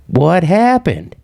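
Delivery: high, drawn-out questioning; mock exasperated question. Himself pressing the caller for answers, drawn-out mock exasperation. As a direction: stretch the question out slowly, pitched high.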